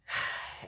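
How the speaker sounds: real unvoiced sigh. Real, not acted. Pausing in a tense date story, a sigh. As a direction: let out a long breath.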